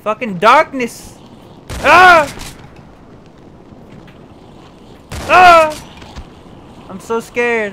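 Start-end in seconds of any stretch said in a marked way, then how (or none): 1.8-6.9 loud high frightened shriek; startled scream. Real, not acted. Gamer alone in the dark, frightened scream. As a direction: scream at the scare. Scared by darkness.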